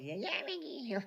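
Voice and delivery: high, squeaky mimicry of a friend; squeaky Arturo voice. Imitating Arturo calling Mickey, high and squeaky. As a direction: pitch it up and squeak it out. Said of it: "He got that squeaky Itali- uh, Italian, uh, French voice".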